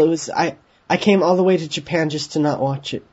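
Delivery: normal talking voice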